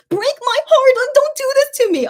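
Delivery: high, loud wail of protest; heartbroken pleading. Real, not acted. Fan dreading a last talk before the military, heartbroken pleading.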